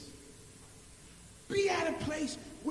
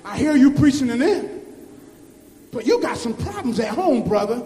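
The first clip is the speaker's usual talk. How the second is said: lower, loud mock rebuke from the pew; wife teasing her preacher husband from the pew, in a lower voice; wife's rebuke; 4 semitones lower, 14 dB louder than usual. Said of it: "my wife's gonna be sitting in that pew".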